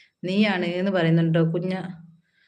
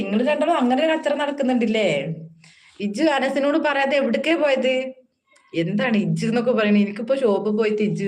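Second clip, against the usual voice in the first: Malappuram dialect; 4 semitones higher than usual. Retold or imitated. Host teasing two viewers in a Malappuram dialect voice. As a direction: put on Malappuram speech, pitched up and playful. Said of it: "ഇത് ശരിക്കും മലപ്പുറം ഭാഷയാണ്".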